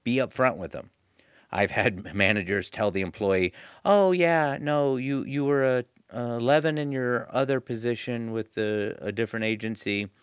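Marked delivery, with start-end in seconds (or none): 3.9-10.1 high, breezy fake reassurance; mock manager brush-off. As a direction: brush the employee off airily. Manager fobbing off employee, mocked.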